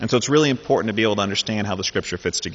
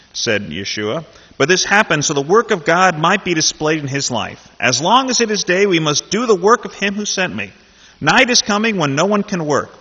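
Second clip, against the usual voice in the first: higher, louder dramatic Bible reading; scripture reading; 5 semitones higher, 7 dB louder than usual. Acted. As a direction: read the gospel story with lively voicing of each figure. Reads John chapter nine aloud.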